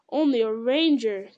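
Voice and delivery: loud, emphatic echo of ONLY A RANGER; indignant exclamation, ONLY and RANGER punched; exclaiming cry. A narrator's reading. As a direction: throw back "Only a ranger!" in loud protest. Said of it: "cried Gandalf".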